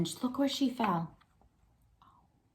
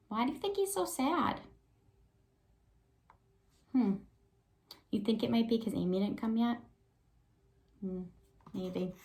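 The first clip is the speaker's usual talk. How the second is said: ordinary talk, nothing marked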